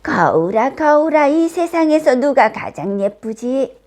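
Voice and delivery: theatrical queen questioning her mirror; queen's demand. A narrator's reading. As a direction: call out imperiously to the magic mirror. Queen calls her magic mirror.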